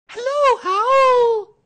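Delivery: put-on very high, loud childlike squeak; excited squeak. A narrator's reading. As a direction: squeal the greeting out at full volume. Excited Roo squeaks greetings again.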